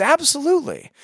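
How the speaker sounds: a light imitation of her glad consent; eager yes. Retelling the woman agreeing to be prayed for, high and eager.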